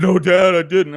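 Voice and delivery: mock kid crying innocence; whiny denying boy. Sean denies it to his dad, whiny and loud.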